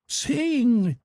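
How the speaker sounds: high, drawn-out shouted command; shouting. A narrator's reading. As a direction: call the order out high and long. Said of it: "I heard Montgomery shouting".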